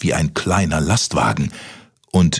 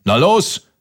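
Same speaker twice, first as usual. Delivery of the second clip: short impatient mock roar, high; roaring giant; 10 semitones higher, 3 dB louder than usual. A narrator's reading. Giant presses Kaspar for an answer, high bellow. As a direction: push it out as an urgent demand. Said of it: "brüllte der Riese von oben".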